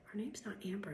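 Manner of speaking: normal talking voice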